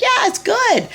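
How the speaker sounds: put-on a small kid delighted by a treat; childlike Eve. A little girl tells the snake the apple is good, high and eager.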